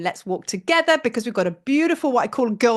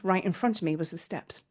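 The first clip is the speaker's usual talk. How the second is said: hushed; 5 semitones lower, 9 dB quieter than usual. Acted. First telling, hushed.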